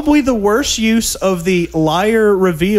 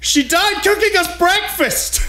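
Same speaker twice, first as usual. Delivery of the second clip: all caps yell; 13 semitones higher than usual. Retold or imitated. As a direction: belt it like a movie line. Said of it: "this is in all caps".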